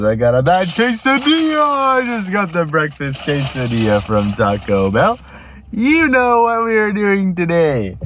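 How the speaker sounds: high, drawn-out joke delivery; hammed-up punchline. Man delivering a quesadilla pun to his friend, hammed up. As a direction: belt out the silly punchline, stretched high.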